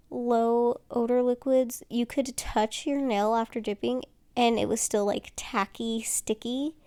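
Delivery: ordinary talk, nothing marked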